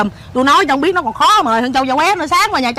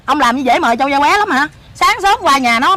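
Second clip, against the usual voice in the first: ordinary talk, nothing marked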